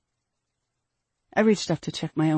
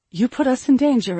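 louder, higher accusation; stern reproach; 7 semitones higher, 6 dB louder than usual. A narrator's reading. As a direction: throw the blame at the child sharply. Mother justifies the slap.

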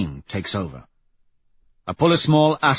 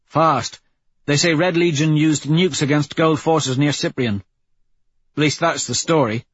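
higher, louder squad member's report; Pebble, crew news report; 5 semitones higher, 4 dB louder than usual. Acted. Pebble tells the crew what Holo News says, reporting. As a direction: announce it to the crew in a raised voice.

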